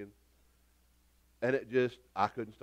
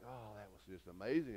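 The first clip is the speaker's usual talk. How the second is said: softly awed; 7 semitones lower, 15 dB quieter than usual. Retold or imitated. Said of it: "And I'm just like".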